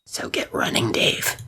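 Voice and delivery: hushed threatening taunt; menacing whisper. Acted. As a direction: whisper it like a stalker.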